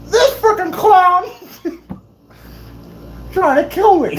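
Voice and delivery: high, dragged-out comic indignation; numb mouth. Retold or imitated. Patient explaining to mom, numb-mouthed mock outrage. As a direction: complain as if lips are numb, near laughing. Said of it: "with, like, a numb freaking mouth".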